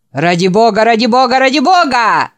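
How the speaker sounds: loud mocking chant, climbing in pitch; hoarse chorus. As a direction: chant it hoarsely like a jeering mob. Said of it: "повторил хриплый хор". (хриплый хор, всё выше и громче)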